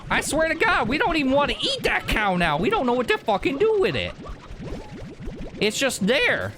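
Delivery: high-pitched, exasperated mock rancher; put-on rancher. Rancher fed up with chickens and cow, high hammed-up exasperation. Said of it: "I don't know why I'm talking like this".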